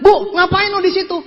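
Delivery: loud, high startled question; startled call. Retold or imitated. Narrator finding his sick landlady at the table, startled. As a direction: call out in mock astonishment.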